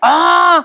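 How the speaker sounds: sharp high startled cry of outrage; loud cry. A narrator's reading. Priya yelps when pickle oil spills on her dress. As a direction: yelp as oil hits the dress. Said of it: "Priya मोठ्याने ओरडते".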